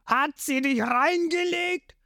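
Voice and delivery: a hint of a sneering fish-man boss; mock him slowly; villain's sneer. A narrator's reading. Along mocking Zorro about Nami, lightly sneering.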